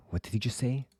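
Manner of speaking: whispered in disbelief; whispering in shock at the doctor's compliment; stunned whisper. Real, not acted. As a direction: whisper it, thrown. Stunned aside about doctor's words.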